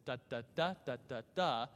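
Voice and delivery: da da DA lilting beat; rhythmic scat of light meter; anapestic rhythm. Shown as a demonstration. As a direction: bounce the beat, light and skipping. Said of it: "just with this da".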